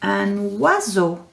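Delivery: "Un oiseau" with a clear wa sound, slow; slow French word. Shown as a demonstration. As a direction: stretch "oiseau" so the wa is heard. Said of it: "Are you ready for this one?".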